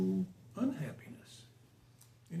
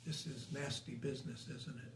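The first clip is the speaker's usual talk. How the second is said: much softer and lower, weak voice; feeble murmur; 6 semitones lower, 10 dB quieter than usual. Retold or imitated. Weak guru to the disciple washing him, feeble and hushed. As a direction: quote it low and quiet, as if very frail.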